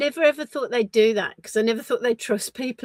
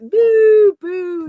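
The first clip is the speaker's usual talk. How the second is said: booing; 10 semitones higher, 10 dB louder than usual. Retold or imitated. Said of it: "And, and I was like".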